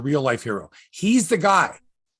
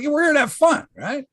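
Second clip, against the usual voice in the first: cheerful crowd; 12 semitones higher, 5 dB louder than usual. Retold or imitated. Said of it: "everyone was like".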